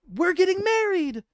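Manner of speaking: very high, loud squeal of joy; high squealing we're getting married; thrilled announcement. Acted. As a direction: burst out the happy news. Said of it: "she says".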